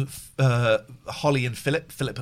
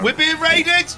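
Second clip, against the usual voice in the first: excited shout; 12 semitones higher, 7 dB louder, slower than usual. Acted. Raid alert shouted.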